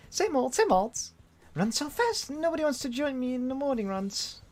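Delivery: put-on high-pitched girl's voice; peppy girl character. Emmy catching up with her friend, high girlish complaint. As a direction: keep it high, complaining lightly.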